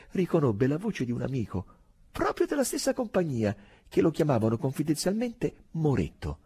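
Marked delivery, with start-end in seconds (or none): none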